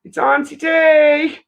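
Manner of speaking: high, loud and slow announcement; sing-song call. Retold or imitated. Aunt announcing herself to her nephew, high and drawn out. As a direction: call it out so he hears from upstairs. Calling nephew through the house.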